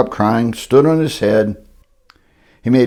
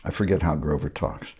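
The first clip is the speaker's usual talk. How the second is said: ordinary delivery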